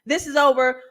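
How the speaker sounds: ordinary talk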